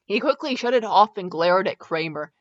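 usual speaking voice

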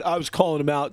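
usual speaking voice

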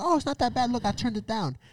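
mock reassurance. Quoting himself reassuring girlfriend.

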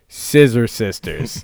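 said very slowly, each word stretched; slow drawn-out delivery. Pretend. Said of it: "just Keith going".

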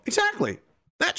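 sudden falsetto exclamation; high squeaked agreement. Pretend. Streamer agreeing with a viewer's message, high squeak.

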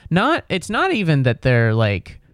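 ordinary talk, nothing marked

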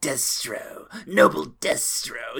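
slow, lingering on the S sounds; snaky cartoon villain. Imitating the cartoon Cobra Commander greeting Destro, slow and snaky. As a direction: draw out the hiss like a serpent. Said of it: "he always lingered on the S sounds".

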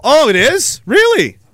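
high, incredulous "really?"; mock surprise. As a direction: play it wide-eyed and shocked. Acted naive questioner.

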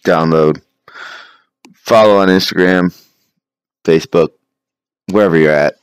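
loud upbeat podcast promo read. Acted. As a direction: read it like a sponsor plug.

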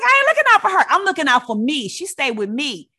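an auntie defending her house rules; auntie snapping back. Retold or imitated. Auntie snaps back at narrator.

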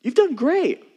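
high, loud and beaming; bursting with pride; enthusiastic praise. Retold or imitated. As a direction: cheer them on like a proud father. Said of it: "He's like".

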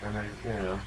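ordinary talk

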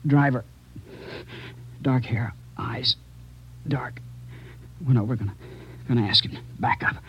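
weak, dying. Acted. Dying officer recounts shooting.

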